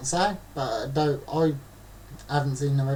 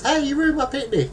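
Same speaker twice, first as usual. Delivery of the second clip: mock woman; 9 semitones higher than usual. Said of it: "the woman goes".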